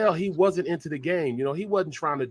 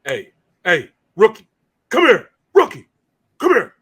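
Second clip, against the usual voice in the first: loud, high mock calling-out; retold summons; 5 semitones higher, 9 dB louder than usual. Veteran summoning the rookie who yelled at him, retold loud summons. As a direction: call out sharply as the annoyed veteran.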